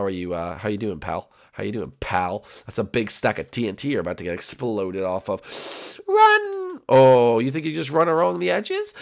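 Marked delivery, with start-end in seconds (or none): 6.1-6.8 very high, stretched-out cry; mock warning cry. Gamer to a player on TNT, high mock warning. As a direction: squeal the warning in falsetto.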